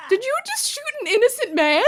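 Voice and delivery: high, shocked disbelief; aghast. Real, not acted. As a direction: cry out in disbelief. Aghast at villain.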